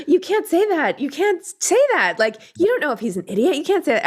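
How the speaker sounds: put-on high, urgent re-enactment of correcting a child; retold motherly reproof in a high voice; mock parental scolding. Retelling her scolding of son.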